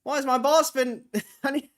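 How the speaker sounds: high, loud newsboy cracking up. Pretend. Newsboy complaining about the boss, high voice dissolving in laughter. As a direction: holler like a newsboy, breaking into laughter. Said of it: "Just so I can talk in that voice".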